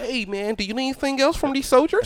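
high put-on voice of a coworker; coworker impression. Coworker asking about supplies at work, high mock voice. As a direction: imitate him calling out, pitched well up. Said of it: "'cause at work, he be like".

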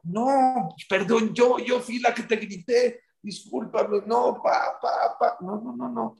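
high, stumbling apology; flustered embarrassment. Retold or imitated. Woman apologises to the man she yelled at, mortified. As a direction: act out a mortified, tumbling protest. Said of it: "le dio vergüenza a ella".

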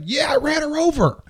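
put-on high, giddy drunk girl. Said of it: "She's like".

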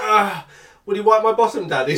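put-on a touch of a high, sly child voice; sinister toddler. Father imitating his son on the potty asking for a wipe. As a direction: lightly voice the child asking, a bit creepy. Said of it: "and then he goes".

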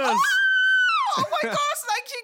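very high, very loud joyful shriek; shrieking with delight; ecstatic scream. Acted. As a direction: scream with overwhelming joy. Hearing she got first class.

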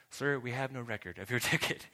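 imitating a bored ticket agent; deadpan agent. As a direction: mimic the stubborn counter clerk. Imitating airline agent repeating refusal.

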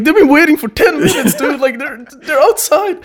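high, loud, laughing mock panic; frantic roommate imitation. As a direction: yell it high like an alarmed roommate.

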